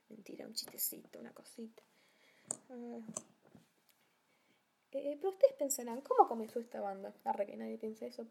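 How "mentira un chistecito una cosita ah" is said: whispered retraction; whispering. Real, not acted. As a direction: whisper it playfully.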